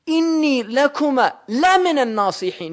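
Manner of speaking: la in "Inni lakuma la minan nasihin" hit high and loud; emphatic la. Shown as a demonstration. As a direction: lean hard on "la", as a sworn assurance. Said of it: "when you use a lam the second time".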